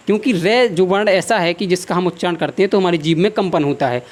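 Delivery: ordinary talk, nothing marked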